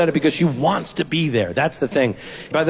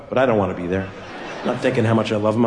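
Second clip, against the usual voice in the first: usual speaking voice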